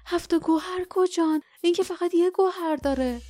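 high-pitched, amazed questions, as if out of breath; breathless astonishment. A narrator's reading. Manus seeing the belt of Deltora, breathless and amazed. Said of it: "نفس زنان گفت".

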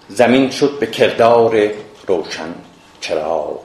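slow, measured epic poetry reading; verse recitation. Acted. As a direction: recite the couplets with long pauses between phrases.

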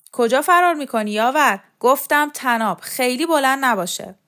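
insistent shouted command; shouted order. A narrator's reading. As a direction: holler after someone running off. Shouted orders at fleeing old man.

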